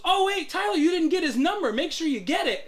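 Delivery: high, loud, chirpy mimicry; hammed-up cheerful reminder; mock oblivious coworker. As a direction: play the clueless coworker brightly. Imitating oblivious coworker Frank.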